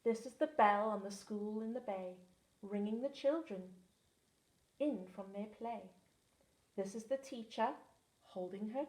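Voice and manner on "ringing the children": plain reading voice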